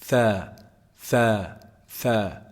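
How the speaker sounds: tha with tongue tip out between teeth; Arabic tha, tongue visible. Shown as a demonstration. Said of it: "pronounced from the tip of the tongue".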